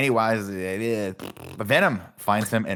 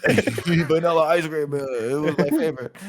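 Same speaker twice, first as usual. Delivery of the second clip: slow, higher mock voice with a laugh; jokey voiced line; 6 semitones higher, 3 dB louder than usual. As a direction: drawl it out like a playful character. Jokes in a character voice.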